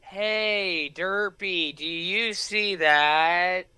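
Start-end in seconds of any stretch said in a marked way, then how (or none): none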